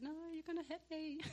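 put-on high-pitched kid's protest; mock childish whine, pitched well up; imitating giggling child. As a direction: play a child refusing through giggles. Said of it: "Shania was just laughing".

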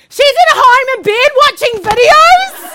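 loud, high-pitched acted disbelief; so surprised. Husband telling on his wife to the preacher, astonished. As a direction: blurt it out in comic astonishment. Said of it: "He is so surprised".

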